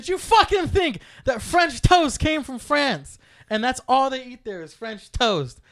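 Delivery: high, loud, slow retold challenge; exasperated. Brother challenging his sister about French toast, exasperated. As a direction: hammer out each word in disbelief.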